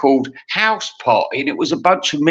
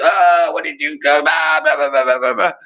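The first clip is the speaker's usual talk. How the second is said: mock ranting dad. Imitating a brute father going mental, blustering mock tirade. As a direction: rant into babbling nonsense. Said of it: "he'd go mental if you did".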